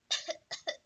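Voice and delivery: a small fake cough. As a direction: cough to sell it. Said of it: "adding a cough at the end".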